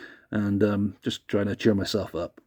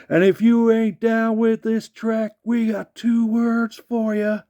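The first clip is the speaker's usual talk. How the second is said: high, slow hyped ring call; high stretched wrestler hype; Billy Gunn catchphrase; 11 semitones higher, slower than usual. Retold or imitated. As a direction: yell it out to the crowd, slowly.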